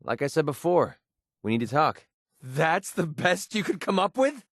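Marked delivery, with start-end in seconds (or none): 2.4-4.4 slow, high-pitched, incredulous question; scornful disbelief. Acted. Taunting someone over a weak effort, scornful. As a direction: draw it out, mocking their effort.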